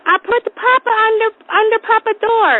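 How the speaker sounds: put-on high, babyish child voice; little toddler. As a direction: confess like a small kid. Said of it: "And Kyler goes".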